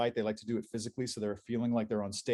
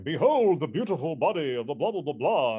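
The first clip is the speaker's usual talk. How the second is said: louder, booming bodybuilder boast; muscleman character voice; 2 semitones higher, 4 dB louder than usual. Retold or imitated. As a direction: boast like a proud musclebound hero. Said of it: "that was the voice I gave him".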